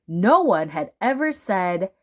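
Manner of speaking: ordinary delivery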